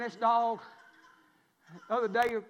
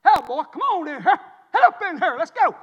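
loud, raised-pitch calling; acted-out shout to come in; hollering for the dog; 7 semitones higher, 14 dB louder than usual. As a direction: holler it across the yard. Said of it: "Then I go".

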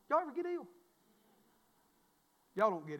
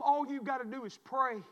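ordinary talk

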